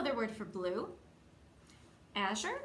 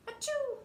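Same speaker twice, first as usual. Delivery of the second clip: very high acted sneeze; a big cartoon achoo; sudden squeaky sneeze. Bean sneezes in story.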